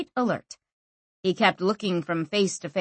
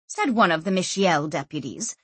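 ordinary delivery, nothing marked

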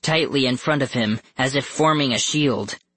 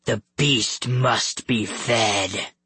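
very slow, gruff monster voice; drawn-out monster threat; menacing beast; much slower than usual. A narrator's reading. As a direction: intone it ominously as the Beast. Beast intones his hungry threat.

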